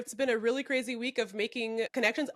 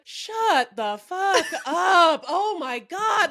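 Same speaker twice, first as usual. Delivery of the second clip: loud, high, drawn-out mock outburst; retold exasperation; 7 semitones higher, 7 dB louder, much slower than usual. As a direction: stretch each word in exaggerated annoyance. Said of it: "I was pissed off".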